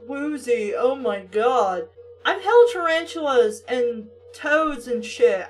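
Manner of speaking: ordinary talk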